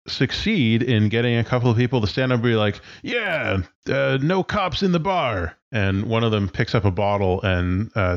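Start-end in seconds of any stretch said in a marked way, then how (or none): 3.0-5.6 rowdy bar patron. Acted.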